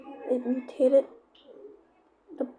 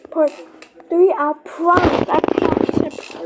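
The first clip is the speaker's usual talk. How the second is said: pleading promise; 4 semitones higher, 8 dB louder than usual. Real, not acted. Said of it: "pleading to keep talking".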